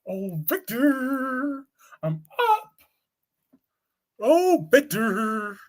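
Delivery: high, drawn-out falsetto calls of Victor; mock Nikki calling. Mocking Nikki waking her husband Victor, high sing-song calls. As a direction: sing out his name like a clingy wife.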